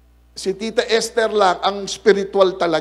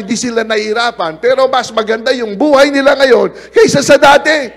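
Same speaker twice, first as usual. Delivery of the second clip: louder, higher preaching at full force; 7 semitones higher, 7 dB louder than usual. Acted. Pastor telling church their lives changed, loud preaching. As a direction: drive the point home to the church.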